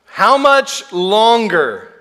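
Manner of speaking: slow, high whining plea; whiny voice. Shown as a demonstration. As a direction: whine it like a tired kid. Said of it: "said in a whiny voice".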